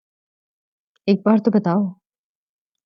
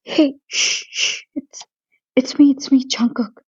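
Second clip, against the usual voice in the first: hushed whisper; 3 semitones higher, much slower than usual. Acted. Hushing her in the dark.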